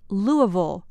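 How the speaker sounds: Louisville said "LOO-uh-vul"; Louisville slurred as "LOO-uh-vul", like a Southerner; Southern pronunciation. Shown as a demonstration. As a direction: say Louisville with the S silent and a swallowed ending. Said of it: "sound like a true Southerner".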